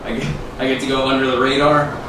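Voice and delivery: ordinary delivery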